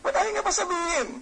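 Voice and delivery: a coworker dying to hear the gossip, high and quick; curious inner voice. Retold or imitated. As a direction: wonder aloud, impatient to know. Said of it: "iniisip mo".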